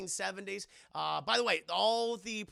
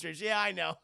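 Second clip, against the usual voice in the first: normal talking voice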